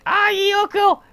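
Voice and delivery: very high cutesy creature voice; Yukuri voice. Retold or imitated. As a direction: pipe it up like a proud little blob creature. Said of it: "the Yukuri was like".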